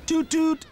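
high, loud toot toot; tooting horn. Acted. Tractor sounding horn.

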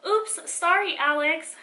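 high, loud, hurried apology; said quickly. A narrator's reading. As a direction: toss off a quick sorry. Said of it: "Hudson said quickly".

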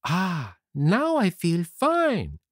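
slow, high, satisfied sigh of relief; contented puppet Pinocchio. Acted. Puppet finishes the last core, high contented voice.

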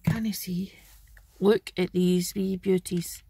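normal talking voice

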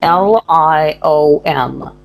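slow, clear letter by letter; slow letter spelling. Shown as a demonstration. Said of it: "How do you spell lion?".

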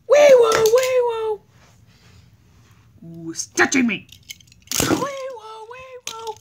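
high sing-song siren wail; police siren. Pretend. Siren during the fight.

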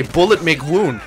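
a teacher explaining to medical students, mock-dumb; mock dumb instructor. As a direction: lecture like a simple-minded drill instructor. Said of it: "they say".

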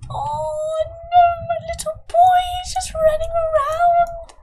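very high, loud cute-overload wail; squealing delight. Real, not acted. Player gushing over her little boy running around, high squeal. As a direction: wail it high, melting with adoration.

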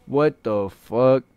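ordinary talk, nothing marked

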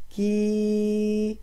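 كي held long, with a stretched ee. Shown as a demonstration. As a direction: draw out كي so the long i rings. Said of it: "أما مد الياء".

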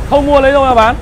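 ordinary talk

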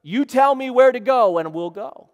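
loud, high soldier's reply; eager army recruit. Retold or imitated. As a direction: answer like a recruit taking orders. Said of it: "when I enlisted in the Lord's army, I said".

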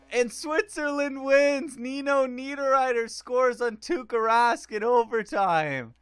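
high, loud, slow victory call; big hockey announcer shout; excited announcing. Real, not acted. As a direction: call the winning goal like an announcer.

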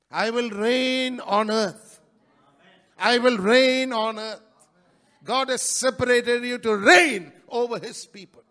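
loud declaration, building in force; full-force preaching. Real, not acted. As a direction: proclaim it loudly to the congregation.

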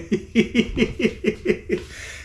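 high-pitched giggling run; long staccato hee-hee; joking laughter. Real, not acted. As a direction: giggle on and on at your own joke. Laughs at own joke.

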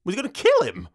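ordinary talk, nothing marked

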